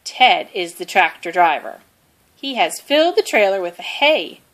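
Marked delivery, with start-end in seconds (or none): none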